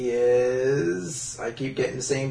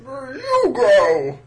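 high goon voice; 11 semitones higher, 4 dB louder than usual. Acted. Goon voice continues.

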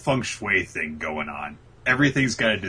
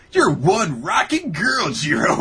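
higher, louder, slow gushing praise; gushing Uraraka; 9 semitones higher, 6 dB louder, slower than usual. Retold or imitated. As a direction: lay the compliment on thick. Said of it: "And Uraraka says".

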